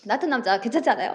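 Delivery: rattled off fast and smug; quick brag. Acted. Blind-date guy rushing his self-praise, fast and smug. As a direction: rush the boastful pitch.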